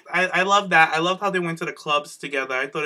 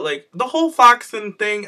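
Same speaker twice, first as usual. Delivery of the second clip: normal talking voice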